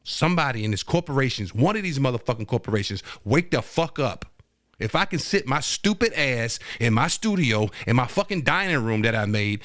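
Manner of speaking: normal talking voice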